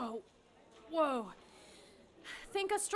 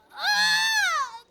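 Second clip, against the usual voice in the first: louder, slow scream of pain mid-sentence; 12 semitones higher, 8 dB louder than usual. Acted. Labour pains.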